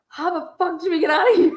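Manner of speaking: high, fast, laughing through the words; half laugh, half cry in disbelief; laughing panic. Retold or imitated. Hiding in the bathroom with her friend, laughing in panic.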